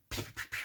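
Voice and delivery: breathy whispered gunshot noises; whispered pew pew. Retold or imitated. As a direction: mouth the laser shots under your breath. Borg Queen fires back.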